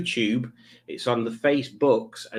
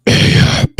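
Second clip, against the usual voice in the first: loud breathy mock Yorkshire greeting; Carl Davis impression; 11 dB louder than usual. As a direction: bark it out as a comic impression. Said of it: "That's how Carl speaks".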